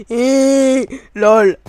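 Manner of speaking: loud laughing yell; laughing shout. Real, not acted. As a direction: crack up at your own pun. Laughing at own pun.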